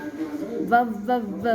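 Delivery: voiced V held; drawn-out V. Shown as a demonstration. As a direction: hold the V buzz. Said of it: "like that".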